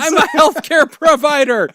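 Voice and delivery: high, loud, laughing protest; laughing shout. Real, not acted. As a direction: defend yourself while laughing. Mock outrage, laughing.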